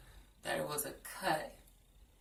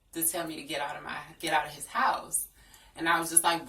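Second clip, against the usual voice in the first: usual speaking voice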